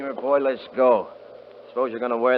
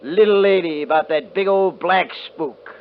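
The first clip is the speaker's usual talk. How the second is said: higher, louder jeering; loud mocking taunt; 6 semitones higher, 5 dB louder than usual. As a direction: bait the old man with a loud sneer. Kurt mocks Joe Sam loudly.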